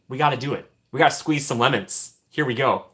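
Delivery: usual speaking voice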